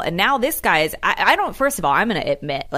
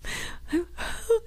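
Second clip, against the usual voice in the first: shaky high sigh; 13 semitones higher, 7 dB quieter than usual. Retold or imitated. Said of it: "the poor guy was like".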